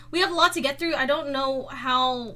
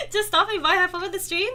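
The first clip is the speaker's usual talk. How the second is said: normal talking voice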